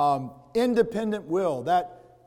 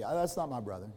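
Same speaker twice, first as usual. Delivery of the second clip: brushing it off, low and muttered; half-acted dismissive shrug; dismissive denial; 5 semitones lower, 7 dB quieter, faster than usual. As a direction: shrug off any blame for the brother. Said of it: "He's denying responsibility".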